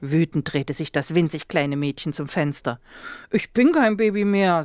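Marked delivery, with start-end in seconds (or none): 3.3-4.6 offended. A narrator's reading. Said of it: "sagte Lotte beleidigt".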